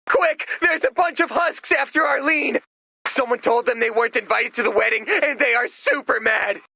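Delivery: high, loud fast warning; urgent alarm. Acted. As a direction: raise the alarm, racing.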